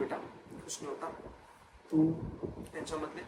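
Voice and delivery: hushed reassuring dad. Retold or imitated. Dad calming him before result.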